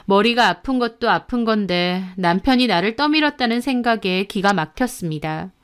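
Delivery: ordinary delivery, nothing marked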